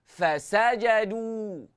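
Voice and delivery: slow "Fasajadu", leaning on "sa"; gentle pressure stress. Shown as a demonstration. Teacher demonstrating intonation, stress on "sa" in "Fasajadu". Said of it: "gentle pressure pada sin".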